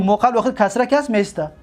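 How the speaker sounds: usual speaking voice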